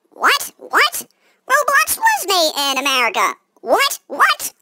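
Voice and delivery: high squeaky disbelieving whats; baffled what. Retold or imitated. The player squeaking at the villains, high and baffled.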